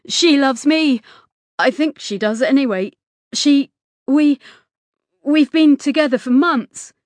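loud, high, halting confession; spilling out suddenly. A narrator's reading. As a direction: blurt it out in agitated bursts. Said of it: "James's words spilt out suddenly".

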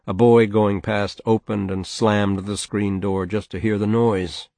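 ordinary delivery, nothing marked

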